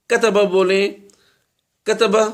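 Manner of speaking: شاگرد کو غلط تلفظ دکھانا: کتبہ آخر میں زائد الف کے ساتھ; wrong drawn-out reading. Shown as a demonstration. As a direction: demo the wrong way: کتبہ with an extra vowel at the end. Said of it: "تو وہ بھی غلط ہوگا".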